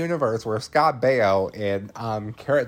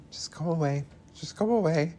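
whispered plea from hiding, higher; 7 semitones higher, 4 dB quieter, faster than usual. Retold or imitated. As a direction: whisper so she won't be found. Ellie hiding in the stall.

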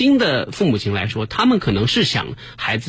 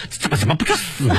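mock grudge. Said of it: "他妈内心肯定咬牙切齿".

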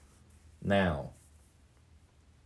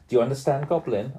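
usual speaking voice

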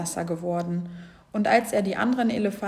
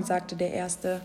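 plain reading voice

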